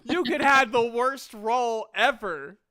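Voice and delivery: usual speaking voice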